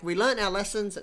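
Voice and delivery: mock smug company voice. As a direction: parody a cocky developer promise. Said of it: "They, they're like".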